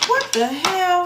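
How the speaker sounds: high, slow shocked exclamation. Real, not acted. Reader startled by the card, high and shocked. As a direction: blurts it out at the card.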